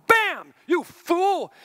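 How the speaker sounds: hammed-up angry verdict; harsh rebuke. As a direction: slam it down like a mock judge. Imagined God scolding harshly.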